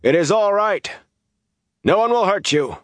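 high, carrying shout of reassurance; drawn-up, high-pitched distance call. A narrator's reading. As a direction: call out to reassure people far away. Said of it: "he shouted".

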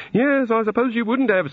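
high-pitched rueful deity; rueful god. A narrator's reading. God answering a wizard about the city, rueful.

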